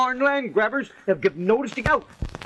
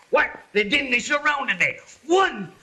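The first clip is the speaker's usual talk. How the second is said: ordinary talk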